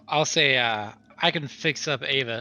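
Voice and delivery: ordinary delivery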